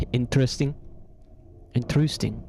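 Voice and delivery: playful Swedish-like "Interesting"; "Interesting" with a rounded, Swedish-style middle vowel; Swedish accent. Pretend. Playing with stress, Swedish guy saying "Interesting". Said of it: "sounds like a Swedish guy saying it".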